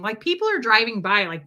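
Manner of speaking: usual speaking voice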